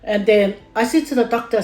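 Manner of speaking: normal talking voice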